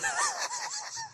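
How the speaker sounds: high wheezing laughter, trailing off; real uncontrolled laughing fit; helpless laugh. Real, not acted. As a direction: crack up and run out of air. Cracks up at the evidence.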